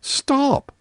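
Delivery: put-on sharp high cry of a cross girl; indignant girl crying stop; cried out. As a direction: stamp it out like a furious child. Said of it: "cried Virginia, stamping her foot".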